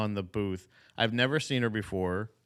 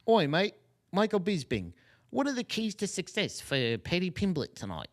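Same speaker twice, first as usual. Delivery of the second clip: chirpy put-on British accent, higher; mock British broadcaster; 7 semitones higher than usual. Podcaster mocking a UFC broadcaster, in a higher, hammed-up British accent. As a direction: ham up a cheery British presenter.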